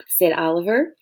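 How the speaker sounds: ordinary delivery, nothing marked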